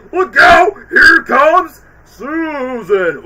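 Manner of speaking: high, loud hammed-up warning cry; mock scary shout. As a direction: shout it, stretching the name out like a monster announcement. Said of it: "Oh, I just scared myself".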